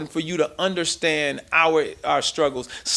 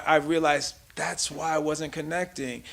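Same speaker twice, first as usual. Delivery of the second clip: ordinary talk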